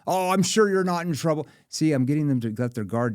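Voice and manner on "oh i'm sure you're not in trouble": higher, louder teasing; playful sales banter. Shown as a demonstration. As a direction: joke around with them. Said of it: "I use a playful tone".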